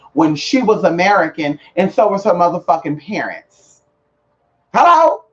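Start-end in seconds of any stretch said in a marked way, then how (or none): 4.7-5.2 loud, high mocking Hello; a sharp high loud Hello of disbelief; incredulous outburst. Real, not acted. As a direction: throw it out like she can't believe it. Outraged at Miss Cleo fakery.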